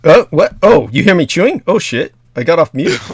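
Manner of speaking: startled loud high exclamations; embarrassed surprise. Real, not acted. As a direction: caught off guard, flustered. Caught chewing, off mute.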